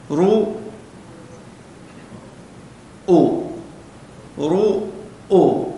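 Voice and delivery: رو- then أو, split apart slowly; syllable by syllable. Shown as a demonstration. Teacher sounds out رؤوس syllable by syllable for students. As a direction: break رؤوس into رو and أو for the class. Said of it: "آه، رؤوس".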